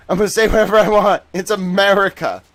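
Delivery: loud, high defiant rant; mock defiance. Streamer defies the stupid government, mock defiance. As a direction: proclaim it loudly like a hammed-up patriot.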